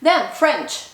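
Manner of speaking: ordinary talk, nothing marked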